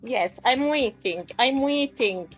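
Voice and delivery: normal talking voice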